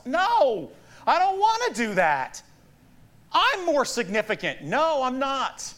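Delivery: put-on high, childish-style refusal of a command; mock protest. Preacher acts out objecting to Paul's verse, mock-defiant. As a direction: push back in hammed-up defiance.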